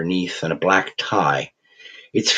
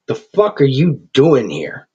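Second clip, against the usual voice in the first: spat; 6 semitones higher, 4 dB louder than usual. A narrator's reading. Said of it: "Tapper spat".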